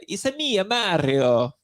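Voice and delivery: high-swooping cartoon Italian accent; sing-song mock Italian, leaping up then dropping; Mario. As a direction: do a hammed-up Italian video game hero accent. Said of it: "your Mario joke?".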